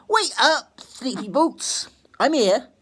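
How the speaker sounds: high, loud playful call; teasing girl. Acted. Meg wakes her dozing father with a high playful call. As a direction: sing it out to wake him.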